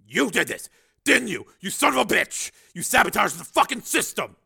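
loud, high, furious charge; angry accusation. Acted. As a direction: hurl the accusation. Major accuses scientist of sabotage.

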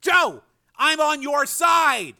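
high, loud, slow appeal; urgent yell. A narrator's reading. Frank to his attacking brother, urgent yell. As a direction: shout to get through to him. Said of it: "Frank yelled".

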